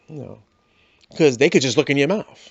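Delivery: ordinary talk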